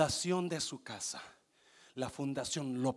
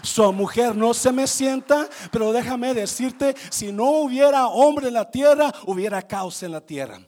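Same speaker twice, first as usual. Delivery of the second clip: loud, high, fervent preaching; sermon at full volume; preaching at full force; 9 semitones higher, 16 dB louder than usual. Real, not acted. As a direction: preach it out with fire.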